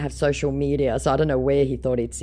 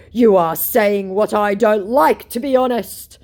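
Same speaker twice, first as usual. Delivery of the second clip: mock sulky scammer; 8 semitones higher, 8 dB louder than usual. The scammer complaining about her replies, high and sulky. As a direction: sulk it out. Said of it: "To which my scammer said".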